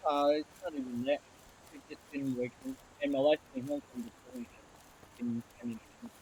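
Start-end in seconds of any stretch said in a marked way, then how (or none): 1.7-6.1 breaking up over a bad mic; choppy, cutting-out audio; scrambled voice. Real, not acted. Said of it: "Your mic is cutting in and out".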